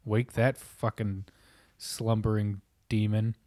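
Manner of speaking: ordinary talk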